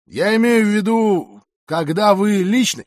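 patronizing. A narrator's reading. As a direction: humour him slowly and loudly, sure of yourself. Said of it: "его голос звучал покровительственно". (покровительственно, громко и растянуто)

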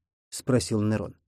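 plain reading voice

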